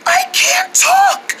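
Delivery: high, drawn-out hoarse squeak; lost voice. Acted. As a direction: strain slowly to get the words out. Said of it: "Why do you sound like that?".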